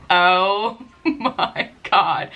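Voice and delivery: very slow oh my God broken by a laugh; stunned at the selfie camera, laughing it out; laughing shock. Retold or imitated. Seeing her head wound on camera, slow laughing disbelief.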